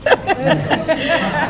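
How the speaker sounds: a quick amused laugh before the punchline; brief chuckle; short laugh. Real, not acted. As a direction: laugh softly to yourself. Laughs before telling a proverb.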